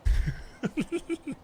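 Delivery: squealing high laugh. Real, not acted. Laughing at sleeping partner.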